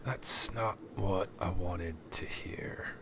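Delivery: slow, whispered, cold; slow menacing whisper. Acted. Boss to the begging captive, slow whispered menace. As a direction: draw out the threat in a whisper. NPC threatening terrified captive.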